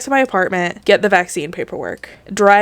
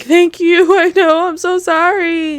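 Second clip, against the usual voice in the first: high, whimpering wail of thanks and apology; mock tearful crying; 10 semitones higher, 4 dB louder than usual. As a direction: imitate blubbering at a cuddly cat, high-pitched. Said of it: "cried when he cuddled with me".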